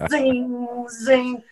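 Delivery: high, sustained ringing "zing"; banjo-string zing. Retold or imitated. Hunter mimicking the barbed wire fence to listeners, high ringing zing. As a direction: imitate a twanging wire with a drawn-out high zing. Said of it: "it's zinging like a banjo string".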